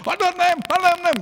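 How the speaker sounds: high, loud wailing cry; keen like a grieving mother; mock lament. Papuan mother lamenting her baby.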